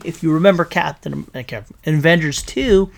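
usual speaking voice

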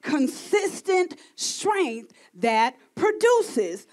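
slow and deliberate. Acted. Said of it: "God said power is pure".

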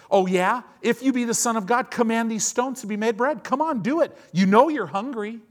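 loud, high, fast goading dare; escalating taunt. Acted. Satan pressing hungry Jesus harder, loud escalating taunt. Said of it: "he gets more and more and more".

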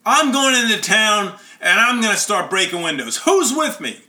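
high, loud rallying cry, retold; imitated yell. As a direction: act out an upset boy rallying the others. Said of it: "he's in tears, and he yells".

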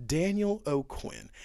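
professional voice. Shown as a demonstration. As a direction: introduce yourself slowly in a polished work voice. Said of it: "this is the voice that you use when you wanna be professional".

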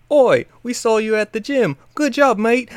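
hammed-up British accent, friendly "Oi" and "mate"; mock British thug. Gamer imitating friendly Team Yell grunts, mock British accent. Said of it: "Team Yell members who are like".

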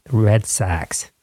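lightly put-on Boston accent, drawn-out Red Sox; mock Boston accent; quick try at a Boston accent; terrible Boston accent. Shown as a demonstration. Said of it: "I have a terrible Boston accent".

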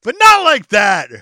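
high, slow, very loud mock yell; indignant shout. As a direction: bellow it like an offended warrior. Repeats the rebuff shouting.

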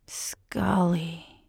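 soft, whispered awe; reverent. A narrator's reading. He addresses his partner in awe, whispered and reverent. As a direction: breathe the name out in reverence. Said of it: "he says reverently".

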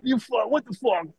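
mock a shocked uncle. Uncle reacts to the nephew selling weed, high and stunned. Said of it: "Al goes".

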